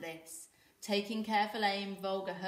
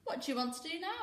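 put-on high boyish question; Knut asking; 10 semitones higher, faster than usual. A narrator's reading. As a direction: ask it in a high kid voice. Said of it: "asked Knut".

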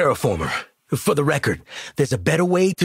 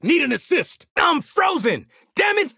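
high, strained battle shout; urgent call for help; 8 semitones higher than usual. Acted. Frozen and calling the squad for assistance, urgent shout. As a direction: climb in pitch as trouble mounts.

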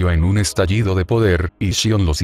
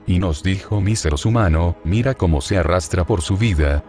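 ordinary delivery

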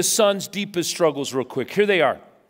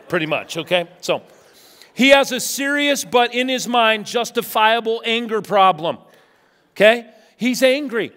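usual speaking voice

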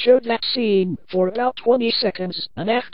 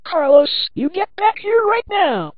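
high, loud call after a runaway; high-pitched furious command; angry command; 10 semitones higher, 4 dB louder than usual. A narrator's reading. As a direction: order the fleeing victim back, high-pitched. Killer calls after fleeing victim.

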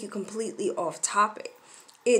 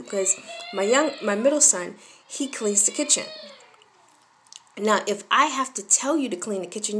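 ordinary talk, nothing marked

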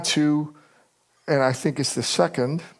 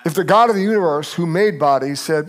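ordinary talk

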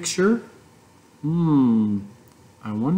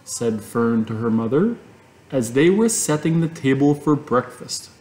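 ordinary delivery

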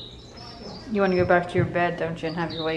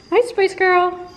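much higher and louder; high pet greeting; 12 semitones higher, 7 dB louder, slower than usual. Real, not acted. Greeting the cat Spice, high and loud. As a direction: sing out a hello to the pet.

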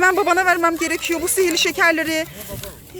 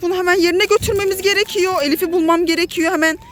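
normal talking voice

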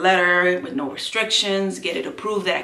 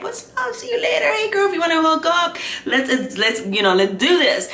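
high, breezy party greeting; bubbly social greeting; 10 semitones higher, 5 dB louder than usual. Acted. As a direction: call out to new friends, high and lively. Acts out greeting everybody at parties.

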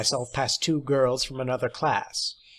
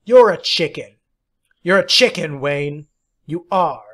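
loud jeering shout; taunting yell; 3 semitones higher, 8 dB louder than usual. A narrator's reading. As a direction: taunt him at full voice. Taunting Wayne.

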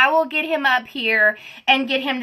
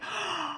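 sharp soft gasp of surprise; retold gasp; 4 semitones higher, 14 dB quieter than usual. Retelling reaching the bottom, sharp gasp. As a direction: gasp as if seeing nothing there. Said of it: "I'm like".

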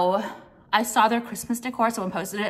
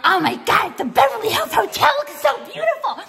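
much higher, laughing fake gushing; mocking gush; 12 semitones higher, 4 dB louder than usual. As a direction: squeal it like a gushing fan you're mocking. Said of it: "numbskulls are like".

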